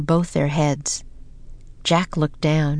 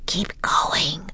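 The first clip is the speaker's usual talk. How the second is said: breathy whisper, urging softly; hushed urgent whisper; 4 dB quieter than usual. A narrator's reading. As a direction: whisper it so the guards can't hear. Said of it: "whispered Annie".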